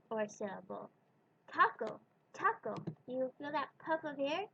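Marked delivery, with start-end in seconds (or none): 1.5-2.7 taco with a breathy puffed t; English t demo; aspirated English t. Shown as a demonstration. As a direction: puff air on the t of taco. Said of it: "We do this too when we pronounce a T".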